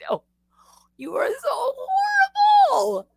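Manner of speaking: very high, tearful mock cry; horrified wail. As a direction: wail the accusation in hammed-up anguish. Retold horrified reply to Dad.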